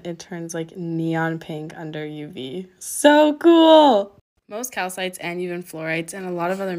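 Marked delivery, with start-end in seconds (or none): 2.8-4.2 high, loud exclamation of delight; wowed, drawn-out cheer; excited. Real, not acted. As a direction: burst out, much higher and louder. Delight at stone glowing under UV.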